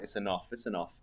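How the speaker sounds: low, soft croak of a tired voice; worn-out voice. Retold or imitated. After five hours reading, the voice croaking that it is done. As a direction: rasp it like the voice is giving out. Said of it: "just your voice goes".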